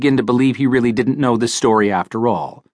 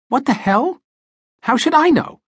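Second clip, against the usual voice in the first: higher, startled outburst of disbelief; surprised; 13 semitones higher than usual. A narrator's reading. Tiftus startled when Parker asks how Joe died, surprised. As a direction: act caught off guard by the question. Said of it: "Tiftus seemed honestly surprised".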